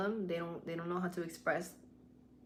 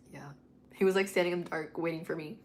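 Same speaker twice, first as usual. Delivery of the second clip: ordinary delivery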